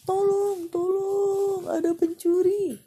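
high, loud cry of thief; shouting for help. Acted. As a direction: yell for help at the market. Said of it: "teriak pedagang daging".

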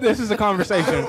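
high loud words through laughter; laughing. Real, not acted. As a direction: blurt it while laughing. Laughing hard during banter.

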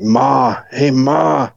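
imitated kid calling Mum; local estate kids. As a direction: put on a local kid's call for attention. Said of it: "these kids just didn't, it was like".

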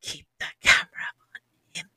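hushed secret instruction to a teammate; retold stealthy aside, fully whispered; conspiratorial whisper. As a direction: whisper it under your breath.

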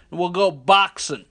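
slow, loud lummox drawl. Retold or imitated. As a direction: drag the words out lazily. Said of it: "he had a drawl".

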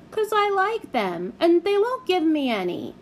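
put-on high childish complaint; a lightly voiced small girl; whiny little girl. A narrator's reading.

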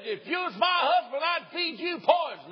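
high, loud mock shout of an angry wife; shrieking angry woman. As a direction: shriek the threat like a furious wife. Angry woman threatens husband.

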